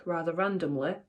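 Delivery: ordinary delivery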